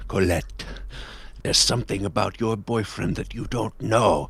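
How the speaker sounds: low, menacing villain voice; quietly sinister, holding the anger in; evil anger. Acted. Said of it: "with evil anger in his eyes".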